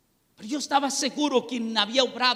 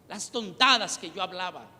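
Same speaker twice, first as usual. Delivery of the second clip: ordinary talk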